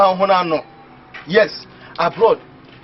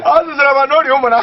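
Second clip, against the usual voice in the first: excited rush; 8 semitones higher, 5 dB louder, much faster than usual. Real, not acted. Excited babble about the money.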